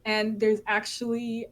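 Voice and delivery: normal talking voice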